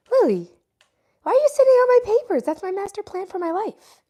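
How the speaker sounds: high-pitched scolding of a pet; mock scolding. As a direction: squeal at the cat in playful protest. Mock scolding the cat on her papers.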